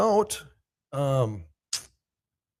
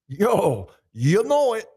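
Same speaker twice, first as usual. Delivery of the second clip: loud, higher-pitched buddy catchphrase; imitating a buddy; 3 semitones higher, 7 dB louder, much slower than usual. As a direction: punch it out in your pal's voice. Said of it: "I read that in your voice too, bro".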